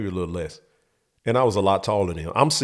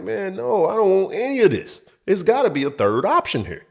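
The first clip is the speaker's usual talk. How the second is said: put-on frustrated kid; 10 semitones higher, 3 dB louder than usual. Kid fed up.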